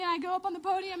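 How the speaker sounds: ordinary talk, nothing marked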